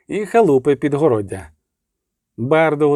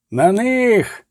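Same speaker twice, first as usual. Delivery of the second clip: higher, shouted charge; yelled battle cry; 6 semitones higher than usual. A narrator's reading. Soldier in the crowd yelling the charge, higher. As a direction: rouse the troops to attack. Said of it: "заволав хтось з воїнів юрби".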